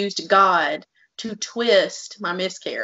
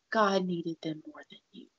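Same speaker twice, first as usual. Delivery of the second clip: bitter whispered quote, much softer; 11 dB quieter than usual. Retold or imitated. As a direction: breathe the words out with no voice. Repeats the hurtful quote, whispered.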